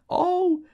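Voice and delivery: very high, loud shriek of laughter; a barmaid shrieking with laughter. A narrator's reading. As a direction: let out a piercing laughing shriek. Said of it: "shrieking Miss Kennedy cried".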